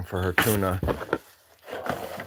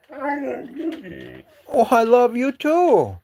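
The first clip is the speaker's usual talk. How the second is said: very high, drawn-out cooing; doting pet talk; 13 semitones higher, much slower than usual. Real, not acted. Owner answering his affectionate dog, high doting coo.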